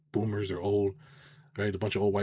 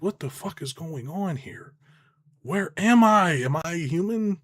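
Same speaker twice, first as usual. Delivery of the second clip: mock bewilderment; 8 semitones higher, slower than usual. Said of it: "I'm like".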